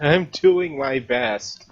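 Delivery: high, loud, drawn-out protest; mock plaintive. Player defending their accent, mock plaintive. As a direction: stretch it into a playful plea.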